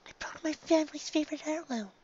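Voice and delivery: upset pony voice. Acted. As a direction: confess it like a guilty pony. Distressed character in preview.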